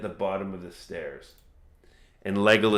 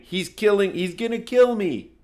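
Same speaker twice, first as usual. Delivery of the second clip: much higher and louder panicked scream; woman screaming in terror; 10 semitones higher, 5 dB louder than usual. Acted. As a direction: scream it like she's about to be killed. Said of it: "she's ear-curdling screaming".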